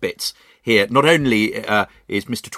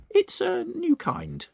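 put-on much higher, slow sly voice of a nasty old woman; Missus Twit; 4 dB quieter, slower than usual. Missus Twit fooling her husband at lunch, high sly voice. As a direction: lie sweetly like a scheming wife. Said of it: "Missus Twit said".

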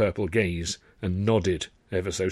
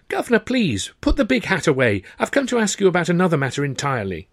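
higher, louder, hurried plea; protesting Sidney; 8 semitones higher, 5 dB louder than usual. Acted. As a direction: stop him before he brings out the hat.

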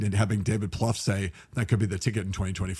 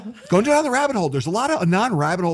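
heated push; 10 semitones higher, 4 dB louder than usual. Real, not acted. Heated political argument.

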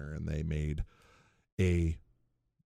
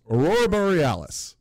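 Aurora Borealis thrown back higher and louder in disbelief; incredulous; 9 semitones higher, 7 dB louder than usual. Retold or imitated. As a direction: repeat "Aurora Borealis" as if it's absurd. Chalmers repeats the excuse incredulously.

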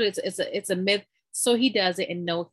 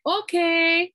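mom's okay; 11 semitones higher, 6 dB louder than usual. Retold or imitated. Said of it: "Mom was like".

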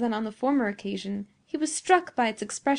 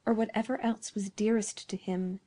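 ordinary delivery, nothing marked

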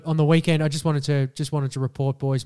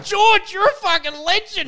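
much higher and louder, cheering; delighted cheer; 6 dB louder than usual. Retold or imitated. Boyfriend cheering the butcher's remark at the counter, mock delight. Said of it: "and I'm just like".

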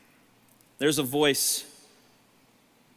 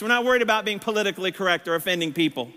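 normal talking voice

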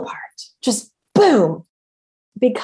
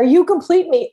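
Jerry Maguire line; 6 semitones higher, 3 dB louder than usual. Retold or imitated. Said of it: "you know, Jerry Maguire".

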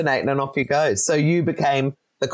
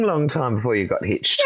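ordinary talk

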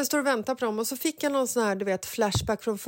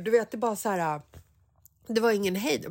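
usual speaking voice

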